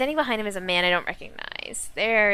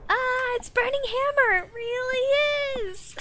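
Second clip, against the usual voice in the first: overjoyed; slower than usual. Acted. Boss overjoyed at recovered product.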